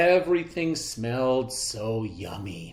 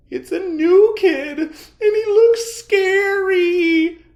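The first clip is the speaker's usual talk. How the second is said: much higher, louder scared cry; frightened cry; 12 dB louder than usual. A narrator's reading. Joey Mackerel seeing the new kid, frightened. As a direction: panic rising. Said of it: "cried Joey Mackerel".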